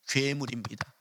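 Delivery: soft, low 괴물입니다 with a falling end; quiet admission. Shown as a demonstration. Preacher contrasts two deliveries.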